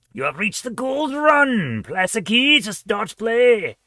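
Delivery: slow, high, drawn-out game announcement; put-on announcer voice. Player reads out the game screen, silly put-on voice. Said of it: "I don't know why I said it in that voice".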